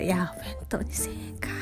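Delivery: whispered inner grumble at price; whispered thought. Retold or imitated. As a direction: mutter it under your breath.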